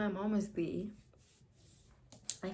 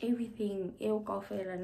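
ordinary delivery

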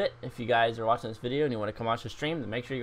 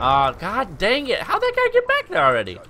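frustrated outburst; 10 semitones higher, 6 dB louder than usual. Real, not acted. Gamer yelling at an enemy behind him, high and loud. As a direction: vent at the game.

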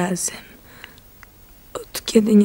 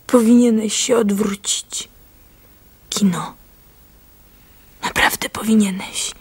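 urgent whisper. Acted. Warning husband of pursuers.

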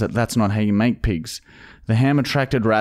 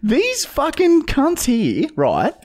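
very high, cartoonish squeak; squeaky YouTube voice. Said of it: "'Cause then YouTube's like".

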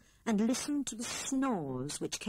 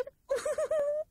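frightened squeal. A narrator's reading.

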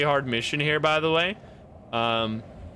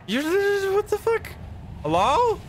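shocked shriek; slower than usual. Real, not acted. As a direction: squeal in shock at the glitch. Shocked at broken NPC.

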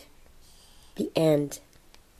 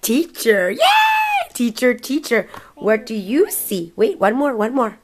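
loud, high happy cheer; excited cheering; 5 semitones higher, 6 dB louder than usual. Real, not acted. Mother cheers child's answer.

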